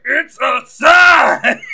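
over-the-top mock bellow; hammed-up shout. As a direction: proclaim it at full volume. Al shouting, retold.

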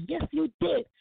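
high, stubborn kid voice, imitated; child's insistent retort. Son insisting to mother, high imitated child retort. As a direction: snap it back like a defiant little boy.

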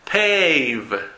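"Pave" stretched, gliding from eh to ee; diphthong demo. Shown as a demonstration. Teacher models two mouth positions in Pave, diphthong demo. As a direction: draw out the glide in "Pave". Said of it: "diptongos que tienen dos posiciones".

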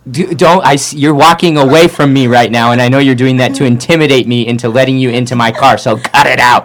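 hammed-up paranoid telling-off; mock indignant. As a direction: driver scolding a stranger, mock indignant. Mock accusing stranger.

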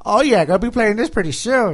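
excited nerdy gamer voice; Gabe nerd voice. Retold or imitated. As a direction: gush like a giddy nerd. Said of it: "why you doing the Gabe Nerd voice".

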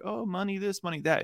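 lightly mocking, high-pitched imitation; mock money-struck chick. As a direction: pitch up into a fawning caricature. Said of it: "most chicks are just like".

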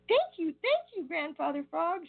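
high, eager cry of thanks; excited shout. Acted. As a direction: call out thanks with glee. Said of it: "shouted all the Merry Little Breezes".

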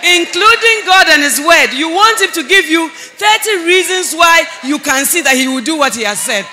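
high, loud preacher's exhortation; full-force preaching. Real, not acted. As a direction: drive it home to the congregation, loudly.

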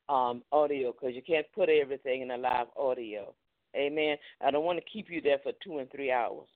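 ordinary talk

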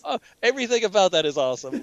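laughing delight. Retold or imitated. Podcaster recalling his reaction to the scene, laughing. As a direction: gush through a laugh. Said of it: "I was like".

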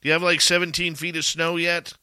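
high, loud, bright hello to a buddy; excited greeting. Real, not acted. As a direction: call out to him with big energy. Host greets a friend on air.

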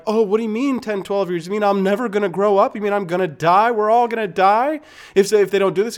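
put-on high, loud, frightened child voice; panicked kid. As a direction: play a scared kid who thinks the world is ending. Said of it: "and they're like".

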